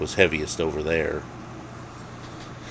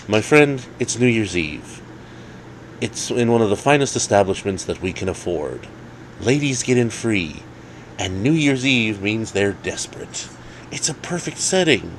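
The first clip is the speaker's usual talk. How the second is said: Jake's voice; 4 semitones higher than usual. Acted. Jake pitching New Year's Eve to Dave, higher voice. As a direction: sell it like a great deal.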